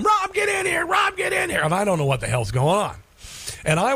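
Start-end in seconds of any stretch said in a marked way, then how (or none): 0.0-1.6 much higher mock scream of the wife; retold scream. Wife yelling from the bathtub overflowing with bubbles, mock panicked shout. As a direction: shout for Rob in a panic, hammed up. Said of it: "screaming from the other room".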